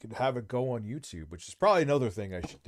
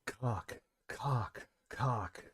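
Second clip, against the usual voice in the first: seductive bird; 9 dB quieter, much slower than usual. Pretend. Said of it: "Rich is now a seductive bird".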